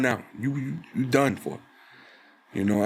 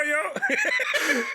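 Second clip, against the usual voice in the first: mock voice. Mock voice, laughing.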